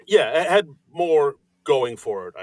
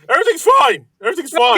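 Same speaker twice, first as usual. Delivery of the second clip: much higher, louder strained insistence; forced fine; 10 semitones higher, 8 dB louder, faster than usual. Pretend. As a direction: insist it's fine too hard. Mock reassurance bit.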